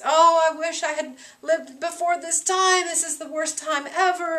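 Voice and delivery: high, overdone lament; mock grieving Macbeth. Teacher voicing Macbeth putting on a false face of grief. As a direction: fake the sorrow, laying it on thick.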